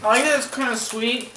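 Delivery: slightly full mouth; talking while chewing chips. Real, not acted. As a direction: between crunchy bites. Seen 3: Woman talks holding chip between bites; eats before and after.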